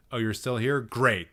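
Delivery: ordinary talk, nothing marked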